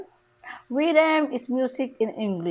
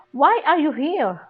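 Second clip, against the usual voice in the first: high tune with "here" lifted then falling; stress on HERE; 5 semitones higher, 4 dB louder than usual. Shown as a demonstration. Teacher shows tonic stress at the end, stress on "here". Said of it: "the stress ... ay makikita doon sa here".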